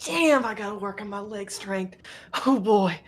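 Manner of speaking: high grunting strain lifting a heavy beam. Acted. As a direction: heave and complain at the weight. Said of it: "She's just like".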